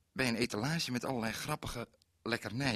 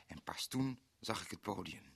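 whispered discovery; 10 dB quieter than usual. Acted. Shocked discovery of stage.

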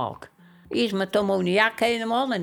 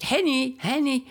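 calling her name, raised pitch; imitated calling out; 7 semitones higher than usual. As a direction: gently mimic his higher-pitched call. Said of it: "dan roept hij dat".

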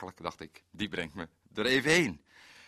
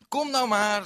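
impatient call; 12 semitones higher, 8 dB louder than usual. Retold or imitated. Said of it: "zei ik".

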